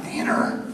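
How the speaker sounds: Dinner? whispered as if foreign; Dinner? tried out like an odd word; Dinner? murmured, hard to say; dinner said his way. Shown as a demonstration. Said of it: "I still can't say that".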